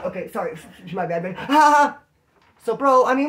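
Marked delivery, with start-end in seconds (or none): 1.4-2.0 high, loud mock belly laugh. Shown as a demonstration. Showing how they laughed after lights out, loud hammed-up laughing. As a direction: burst into exaggerated loud ha-ha-has. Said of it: "Laughing loud".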